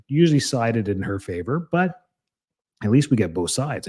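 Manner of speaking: normal talking voice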